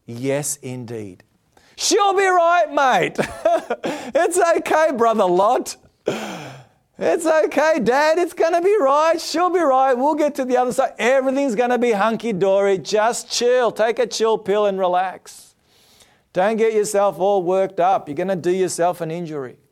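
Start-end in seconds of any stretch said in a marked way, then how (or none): 1.8-3.2 high, breezy dismissal with a laugh; carefree mockery. Mocking the complacent crowd. 3.2-4.1 carefree laugh. Acted. 4.1-19.6 high, easygoing voice waving off worry; breezy brush-off. Acted. Family brushing off Lot's warning.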